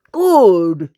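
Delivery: loud eager cry; cried. Acted. Browser the dog cheering the plan loudly. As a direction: snap it out gleefully. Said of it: "cried Browser".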